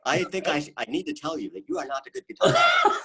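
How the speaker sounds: laughing while mimicking a stern German critic; German accent. Guitarist imitates a German critic, high laughing mock German accent. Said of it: "in a German accent".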